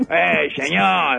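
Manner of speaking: normal talking voice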